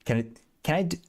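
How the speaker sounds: usual speaking voice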